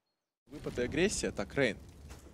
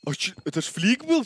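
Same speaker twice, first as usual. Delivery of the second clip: ordinary delivery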